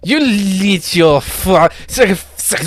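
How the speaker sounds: high, loud, outraged French shopkeeper; french chef. Acted. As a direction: shriek at the thieves in a French accent. Shopkeeper sputters curses at thieves.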